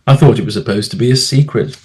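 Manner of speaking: ordinary delivery, nothing marked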